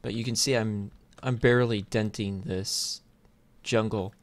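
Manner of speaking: ordinary delivery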